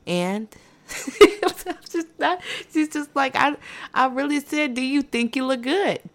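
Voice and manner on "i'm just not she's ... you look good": high-pitched, laughing as you talk; can't help laughing while telling it. Real, not acted. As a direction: giggle through the retold line. Laughs through telling her reply.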